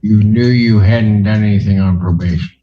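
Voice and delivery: ordinary delivery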